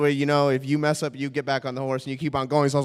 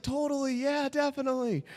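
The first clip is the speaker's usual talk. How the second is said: high-pitched, softer eager yes; overeager agreement; 9 semitones higher, 7 dB quieter than usual. Retold or imitated. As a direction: agree far too keenly with your crush. Acting casual with his crush.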